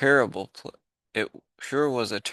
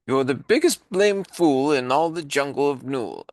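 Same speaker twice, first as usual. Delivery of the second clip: loud, higher jeering kangaroo voice; mocking kangaroo; 5 semitones higher, 6 dB louder than usual. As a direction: jeer at the elephant, loud and high.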